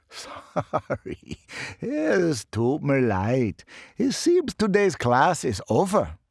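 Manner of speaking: put-on apologetic professor in a light German accent. Professor dismisses the eager class, German-accented. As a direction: wrap up playfully, German lilt. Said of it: "bad accent".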